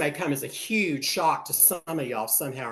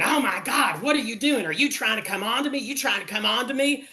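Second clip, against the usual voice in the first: higher, panicky mock yell; mock screaming; 7 semitones higher than usual. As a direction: freak out in a shrieking mock panic. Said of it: "He starts screaming".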